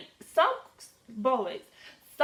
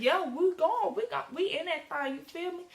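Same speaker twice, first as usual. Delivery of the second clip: hyped; 6 semitones higher than usual. Retold or imitated. Hyped about going.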